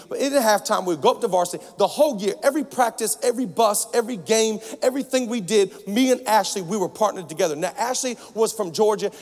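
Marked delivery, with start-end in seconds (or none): none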